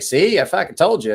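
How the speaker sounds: mock I-told-you shout; told-you jab. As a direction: rub it in with a loud I-told-you. Retelling telling off his friend.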